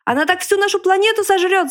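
высокий громкий вопль; завопи в ужасе. A narrator's reading. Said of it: "завопил поросёнок". (громко завопить)

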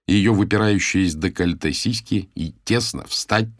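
usual speaking voice

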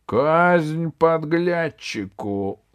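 loud, high, drawn-out cry; shouted sentence. A narrator's reading. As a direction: proclaim the execution at a slow shout. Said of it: "закричал Клоп". (громкий протяжный крик)

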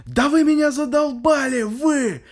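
high, loud furious yell; angry yelling. Retold or imitated. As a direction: yell at the whole stairwell. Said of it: "начинает просто орать на весь подъезд".

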